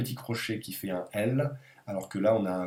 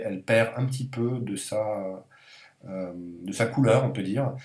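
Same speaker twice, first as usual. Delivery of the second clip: normal talking voice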